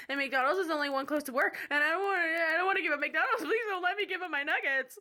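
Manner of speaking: loud, high, building comic desperation; hammed-up panicked rant climbing in pitch; frantic mock pleading. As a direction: beg hysterically as if losing a favorite food. Mock pleading rant over nuggets.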